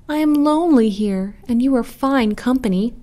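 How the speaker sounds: high-pitched lonely plea; pleading cry. A narrator's reading. Lonely turtle flatters the geese, pleading. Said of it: "cried the turtle".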